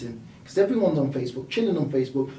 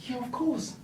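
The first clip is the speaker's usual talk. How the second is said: high, softer, casual yes; breezy mock assurance; 9 semitones higher, 6 dB quieter than usual. Retelling his answer to the dad, breezy assurance. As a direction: toss off a high, light reply. Retold quick reply to the dad.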